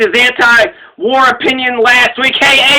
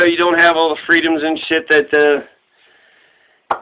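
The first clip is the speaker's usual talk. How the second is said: ordinary talk, nothing marked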